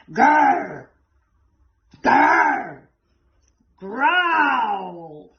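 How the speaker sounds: loud roaring growl. A narrator's reading. The growl at its loudest, a loud roar. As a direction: growl out big. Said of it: "louder and louder".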